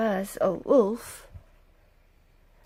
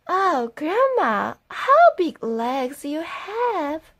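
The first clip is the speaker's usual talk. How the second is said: put-on little girl; 6 semitones higher than usual. Girl asking grandma.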